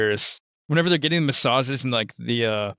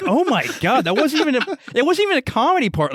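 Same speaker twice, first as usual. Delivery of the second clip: high, incredulous outburst, raised a lot in pitch; shocked disbelief; 12 semitones higher, 4 dB louder than usual. Retold or imitated. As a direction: replay your own stunned reaction, half laughing. Retells own shocked reaction.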